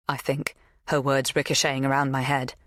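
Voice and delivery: plain reading voice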